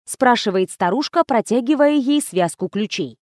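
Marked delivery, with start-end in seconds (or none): none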